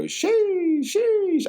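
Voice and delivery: very high, strained falling "sheesh"; mock sheesh. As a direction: squeak out a silly sheesh. Retold guy saying sheesh.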